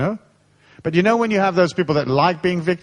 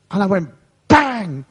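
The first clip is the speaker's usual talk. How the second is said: slow punchy bang; 4 semitones higher, slower than usual. Retold or imitated. Retelling a punch.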